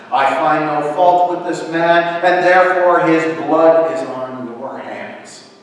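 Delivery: loud declaration. A narrator's reading. Pilate washes his hands of the verdict, declaring loudly. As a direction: declare it firmly to a crowd.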